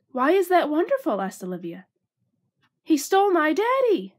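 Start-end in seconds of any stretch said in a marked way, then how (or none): 0.1-1.2 put-on high, louder little girl voice, stress on is; a small girl demanding an answer; puzzled little girl. Olivia questioning Basil, high and indignant. 2.8-4.1 put-on high, loud child's distress; upset little girl. Olivia telling Basil about her father, high and upset.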